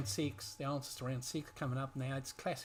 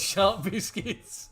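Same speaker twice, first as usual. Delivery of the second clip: laughing; 5 semitones higher, 8 dB louder, much slower than usual. Real, not acted. Laughing repeat of slang.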